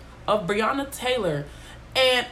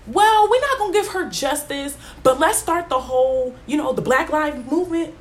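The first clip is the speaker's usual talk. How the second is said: mock officials; 7 semitones higher than usual. Mocking officials' fake concession.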